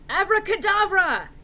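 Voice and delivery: high, loud incantation; hammed-up magic yell; yelled spell. A narrator's reading. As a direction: blast the spell at them. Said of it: "he yelled".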